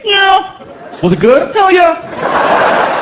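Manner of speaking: put-on high kid voice through laughter; high giggly boy voice. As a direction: play the little boy, laughing. Son voiced answering father.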